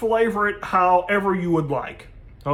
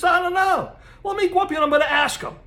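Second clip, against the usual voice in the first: much higher and fast, an eager worker blurting it out; eager grave digger; 11 semitones higher, faster than usual. Acted. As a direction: pitch it way up and rush the words. Joke, Polish grave digger answers.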